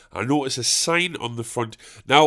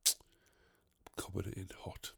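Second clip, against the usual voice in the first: soft, slow whisper of "I'm coming in hot"; hushed secret warning; 8 semitones lower, 23 dB quieter, much slower than usual. Shown as a demonstration.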